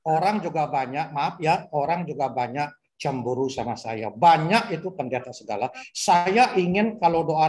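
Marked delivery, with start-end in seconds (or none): none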